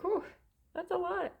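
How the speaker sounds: overwhelmed whew. Real, not acted. Mother marvels at big families, high overwhelmed exclamation. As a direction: blow out the whew like it's too much. Mom of many kids, exclaiming.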